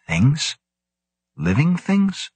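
sharply rising astonished question; can't believe what he heard. A narrator's reading.

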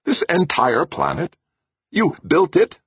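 high, rising incredulity; obvious disbelief. A narrator's reading. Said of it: "in obvious disbelief".

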